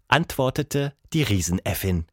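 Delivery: usual speaking voice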